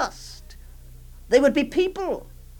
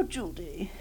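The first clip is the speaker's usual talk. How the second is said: whisper; 10 semitones lower, 14 dB quieter than usual. Acted. Auntie hushing the child.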